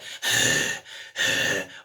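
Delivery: breathy unpitched rasp, twice; two airy hissing growls; distortion demo. Shown as a demonstration. Coach showing one kind of distortion, airy rasp. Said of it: "whether it's finding the".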